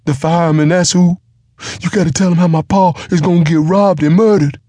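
high, loud, fast panicked pleading; frantic giant half-wit. A narrator's reading. Giant pleads about his pa.

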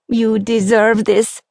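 slow, a touch of happy tears; moved, slowly blessing them; tearful mother. A narrator's reading. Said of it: "began weeping at the sight".

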